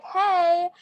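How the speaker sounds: high bleating hey; goat call. Retold or imitated. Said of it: "goat language".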